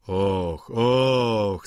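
drawn-out mock-surprised "och"; okrzyk Vautrina. A narrator's reading. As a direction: exclaim slowly in an altered voice. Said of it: "zawołał Vautrin". (przeciągły okrzyk, zmienionym głosem)

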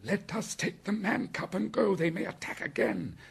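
high-pitched urgent gasping; exhausted gasp. A narrator's reading. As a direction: plead high and out of breath. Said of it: "Bagheera gasped".

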